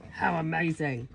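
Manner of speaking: usual speaking voice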